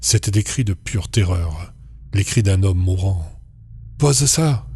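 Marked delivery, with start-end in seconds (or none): 4.0-4.7 high, sharp shouted order. A narrator's reading. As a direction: cry out to make him put it down. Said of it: "a crié sa petite amie".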